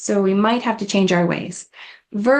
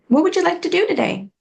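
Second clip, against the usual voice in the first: fast, higher, eager "would"; eager rapid question; 7 semitones higher, faster than usual. Retold or imitated. Eager aide rushing questions at a patient, fast and high. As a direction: rattle it off brightly. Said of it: "I remember asking a gentleman".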